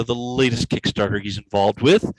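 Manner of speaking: ordinary delivery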